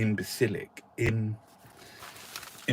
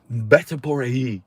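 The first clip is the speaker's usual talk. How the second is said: vete por allí said louder and sharper, pushed away; louder retake; 4 semitones higher, 7 dB louder than usual. Shown as a demonstration. Retaking the phrase for the laughing studio, louder and sharper. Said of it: "depends on the tone of your voice".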